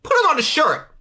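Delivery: way up high and squeaky, joking. Acted. Podcast host joking to cohost in a squeaky voice. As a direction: squeal it like a cartoon.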